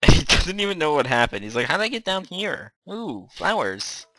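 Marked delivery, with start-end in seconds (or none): 1.7-4.1 high, goofy mock-oblivious voice; clueless skeleton impression. Gamer voicing the clueless skeleton, high mocking voice. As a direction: play a dim, easily distracted creature. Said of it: "that's what he's like right now".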